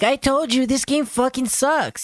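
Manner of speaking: imitating the streamer. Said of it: "and being like".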